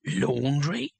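incredulous rising question, no real volume; understated outrage, climbing at the end; mild mock shout. A narrator's reading. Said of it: "he shouted".

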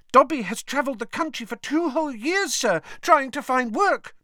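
high, earnest elf squeak; squeaky elf voice. A narrator's reading. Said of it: "Dobby squeaked".

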